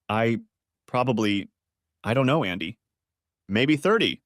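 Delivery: ordinary delivery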